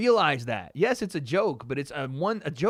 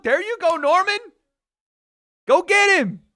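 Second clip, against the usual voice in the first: much higher and much louder, real cheering; excited shout; 10 dB louder than usual. Real, not acted. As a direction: scream him on from the couch. Cheering on his fighter live.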